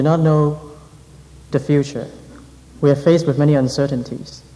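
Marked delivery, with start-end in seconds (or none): none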